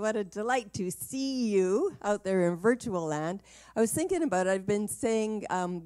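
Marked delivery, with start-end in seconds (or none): 0.0-3.4 bright excited greeting. Real, not acted. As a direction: cheer out a big hello. Excited greeting to online audience.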